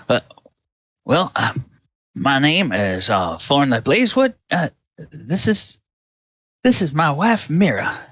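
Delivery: farmer voice. Acted. Farmer introduces himself.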